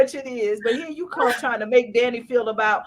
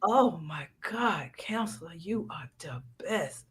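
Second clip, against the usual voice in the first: mock gushing admirer; 6 semitones lower, 10 dB quieter than usual. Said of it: "that's all she doing is".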